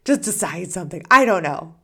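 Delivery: exasperated plea. Retold or imitated. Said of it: "You're like".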